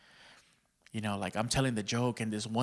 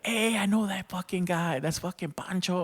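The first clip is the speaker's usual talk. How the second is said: higher-pitched retold exclamation; mock excited callout, pitched up; imitated excited recognition; 7 semitones higher than usual. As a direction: imitate someone spotting a familiar face. Imitates commenters recognizing the man.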